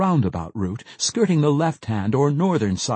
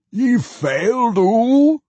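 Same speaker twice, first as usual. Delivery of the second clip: high, slow dwarf mimicking the howl; mocking howl; 12 semitones higher, 6 dB louder, much slower than usual. A narrator's reading. Pwent mimics the mage's howl back at him. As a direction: echo his howl back as a puzzled dwarf. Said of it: "Pwent asked".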